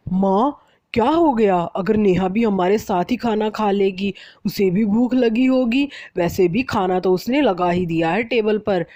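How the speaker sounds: a son gently taking his wife's side; lightly voiced husband pleading for his wife; mild protest.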